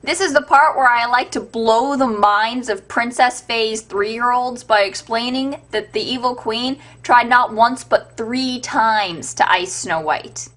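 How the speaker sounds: ordinary talk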